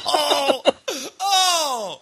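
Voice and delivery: high, laughing groan at himself; real laughing regret. Real, not acted. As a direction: wail through laughter at your own blunder. Laughing at his own stupid remark.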